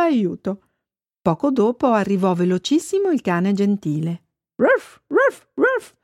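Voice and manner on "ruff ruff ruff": three high imitated woofs; dog barking. A narrator's reading. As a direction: bark like an urgent dog, pitched high. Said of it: "con tutto quell'abbaiare". (abbaiare acuto di cane)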